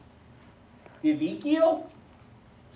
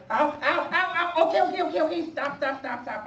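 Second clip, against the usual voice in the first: yelping ow rising higher, then pleading stop; hammed-up ouch giving in; mock pain; 13 semitones higher, 5 dB louder than usual. Being prodded by God until he agrees: mock yelps and pleading.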